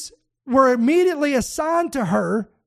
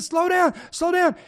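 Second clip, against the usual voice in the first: panicked plea; 6 semitones higher than usual. Retold or imitated. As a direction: mimic a terrified passenger begging the driver. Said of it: "she started screaming and panicking".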